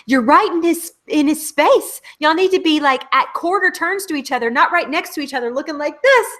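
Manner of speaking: high, quick mock director giving notes; imitating a director correcting Sophie, high and quick. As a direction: play the director telling Sophie where to stand. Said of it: "he didn't say".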